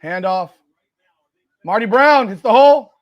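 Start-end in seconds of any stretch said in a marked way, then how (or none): 1.6-2.9 sudden loud call of the run; excited play call. Real, not acted. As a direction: punch out the play as it breaks.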